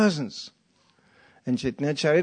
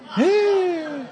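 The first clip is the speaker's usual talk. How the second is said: high, laughing surprised shout; mock shocked cry; 4 dB louder than usual. Homeowner sees dog beside stranger: high shocked shout. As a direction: yelp it out in shock. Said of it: "They opened the door".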